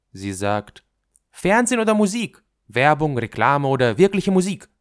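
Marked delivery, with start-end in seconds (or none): 1.3-4.7 put-on loud, high, quick girl's voice; insistent girl. Girl insisting on her question. (drängendes Mädchen, laut)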